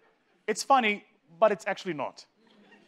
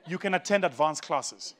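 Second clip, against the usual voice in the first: ordinary delivery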